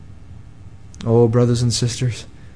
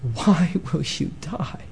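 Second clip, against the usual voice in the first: much softer, hushed plea; pleading Jesus; 11 dB quieter than usual. Acted. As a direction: ask it gently as Jesus pleading. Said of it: "see him plead with you".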